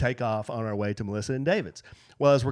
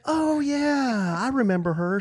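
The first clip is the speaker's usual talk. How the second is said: bright recognition; 10 semitones higher, slower than usual. Retold or imitated. Acting his own retold reaction.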